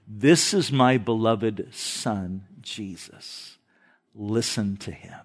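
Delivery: low, slow and hushed divine voice; deep solemn voice from heaven, fading soft; voice of God the Father. Retold or imitated. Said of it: "the voice of God the Father who says".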